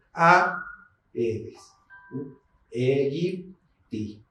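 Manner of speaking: "Aedes" with A and E in separate syllables, "Aegypti" drawn out very slowly; slow correct reading. Shown as a demonstration. Teacher slowly modelling the hiatus in "Aedes". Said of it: "Aedes es un hiato".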